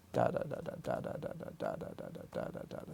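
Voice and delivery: soft whispered "da, da" in a steady slow beat; slow pulse syllables. Shown as a demonstration. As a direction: tap out four pulses per second with "da". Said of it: "something like that".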